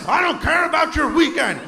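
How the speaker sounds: shouted, high hammed-up office tirade; mock angry boss. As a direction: bark orders down the phone like a furious executive. Angry boss yelling on phone.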